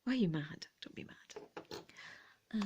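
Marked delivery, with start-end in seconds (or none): 0.8-1.5 whisper. Acted. Soothing a grumpy brooch.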